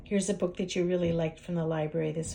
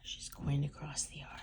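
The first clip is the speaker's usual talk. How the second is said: hushed whisper; 5 semitones lower, 9 dB quieter than usual. A narrator's reading. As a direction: whisper it like a secret. Whispered to child.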